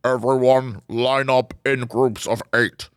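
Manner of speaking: low, slow announcer voice; mock game host. Imitating the game's host to the co-host, low and slow. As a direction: boom out orders like the show's host. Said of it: "there's times where they're like".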